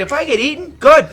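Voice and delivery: loud, high mock rant; hammed-up defiant holler; Ryan impression. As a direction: imitate a buddy daring the danger on. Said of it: "That was spot on".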